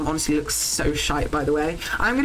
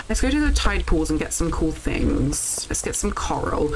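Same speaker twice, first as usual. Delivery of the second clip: ordinary talk, nothing marked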